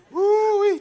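high, loud "woo-wee" whoop. Real, not acted. Whoops over grandparent juice.

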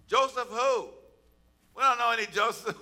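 loud, high, incredulous dismissal; dismissive. Acted. New Egyptian rulers brushing off Joseph's name, dismissive.

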